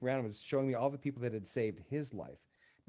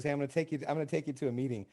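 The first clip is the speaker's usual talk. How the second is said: higher, louder retold tug-of-war; insistent back-and-forth; 6 semitones higher, 6 dB louder than usual. Supervisor badgering a reluctant employee to a meeting, insistent. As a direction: act out pushy coaxing and quick refusals. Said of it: "he insisted".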